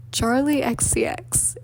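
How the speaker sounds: slow, laboured delivery of the name; drawn-out, careful attempt at the name; struggling to say it. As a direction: spell out "X C X" slowly, letter by letter. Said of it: "I was, like, really struggling".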